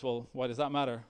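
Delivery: ordinary delivery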